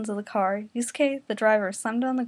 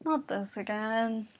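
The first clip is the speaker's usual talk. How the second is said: groaned; 6 dB quieter, slower than usual. Acted. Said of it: "Keiko groaned".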